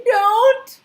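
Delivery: high loud scream. A narrator's reading. Sophie silently screams at the giant, high scream. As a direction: scream it in panic. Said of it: "Sophie wanted to scream".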